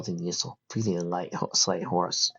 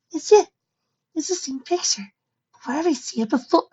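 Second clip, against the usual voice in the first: put-on high, excited young pony; excited filly voice. A narrator's reading. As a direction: recognize it in a rush of excitement. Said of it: "looked down and gasped".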